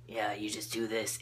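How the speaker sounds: lightly put-on husky teacher voice; quiet hero-style murmur; Batman. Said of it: "that's just the voice I'm gonna give him".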